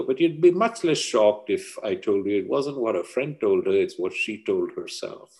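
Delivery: ordinary delivery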